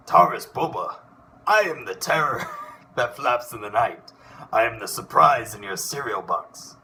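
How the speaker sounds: bold cartoon duck hero declaiming his entrance; Darkwing Duck voice. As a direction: grandly announce each line like a caped duck hero. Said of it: "Darkwing Duck".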